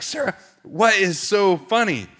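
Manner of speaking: high, slow, baffled demand; exasperated question. Retold or imitated. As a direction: imitate a bewildered husband wanting an answer. Baffled husband asks laughing wife.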